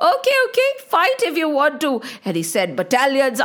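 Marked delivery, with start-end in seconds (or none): 0.1-1.9 high-pitched, offhand acceptance; mock-indulgent taunt; dismissive villain. A narrator's reading. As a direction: wave the challenger off, pitch jumping up.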